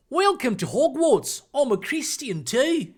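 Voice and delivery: broad Australian slipping toward Cockney; Australian accent. Acted. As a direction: give a welcome in a wobbly put-on Australian accent. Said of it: "my Australian sometimes goes a bit into Cockney".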